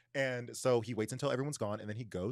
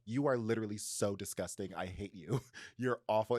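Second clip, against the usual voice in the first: normal talking voice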